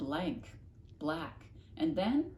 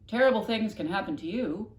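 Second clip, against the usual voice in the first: louder, ominous title read; comic-book title announced; dramatic title; 2 semitones higher, 9 dB louder than usual. Acted. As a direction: announce it like a warning. Title again.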